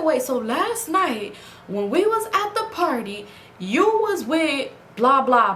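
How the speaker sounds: slow, high accusing question. Retold or imitated. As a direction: drag it out, suspicious.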